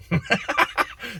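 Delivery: high-pitched delighted laugh. Real, not acted. Delighted at own idea.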